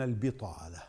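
البطالة with a short i after the ب; kasra on the ba. Shown as a demonstration. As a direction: say "bi-" at the start of البطالة, as biṭāla. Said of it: "بكسر الباء". (البطالة بكسر الباء)

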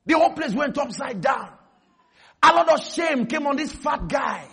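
normal talking voice

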